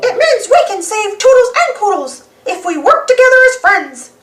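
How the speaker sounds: high squeaky cartoon mouse voice; high and earnest; Mickey Mouse. Mickey explaining teamwork to Pete, high and earnest. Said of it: "says Mickey".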